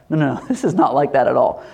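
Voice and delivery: ordinary delivery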